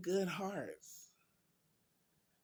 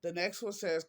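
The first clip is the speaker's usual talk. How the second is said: ordinary talk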